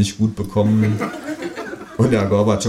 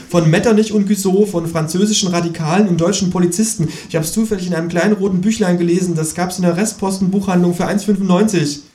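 higher-pitched, hurried ghost voice; harried ghost; 10 semitones higher, faster than usual. Acted. Hunted ghost explaining who pursues it, high and aggrieved. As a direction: pour out the list of persecutors.

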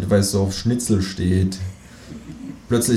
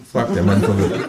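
ordinary delivery, nothing marked

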